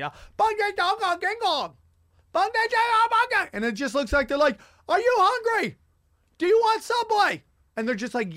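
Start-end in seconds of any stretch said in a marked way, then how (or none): 0.4-3.5 very high mock Chinese shouting; loud fake Chinese. Shown as a demonstration. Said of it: "the most stereotypical Chinese, fake Chinese". 4.9-7.4 high, loud mock shouting; yelling in faces. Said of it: "yelling in each other's faces".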